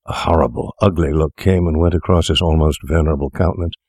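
usual speaking voice

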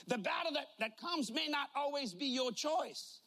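ordinary delivery

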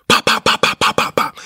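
sharp pop pop gunshot sounds; imitated gunshots. Telling of the shooting, mimicking the gunfire. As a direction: mimic the shots ringing out.

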